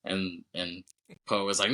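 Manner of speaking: normal talking voice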